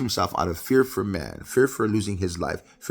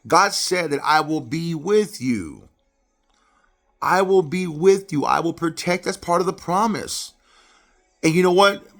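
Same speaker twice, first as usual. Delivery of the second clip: loud, higher-pitched sermon delivery; preaching; 6 semitones higher, 6 dB louder than usual. Real, not acted. Preacher teaching congregation about Isaac's fear, emphatic preaching. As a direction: preach it out, driving home key words.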